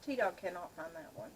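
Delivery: ordinary delivery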